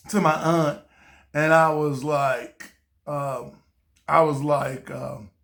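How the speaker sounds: ordinary talk, nothing marked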